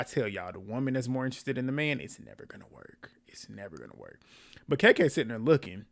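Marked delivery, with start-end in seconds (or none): 2.0-3.1 whispered warning, almost no voice. Real, not acted. Repeating her rule in a hush. 3.3-4.2 hushed repeat with a touch of voice; whisper. Real, not acted. Repeating her rule in a hush.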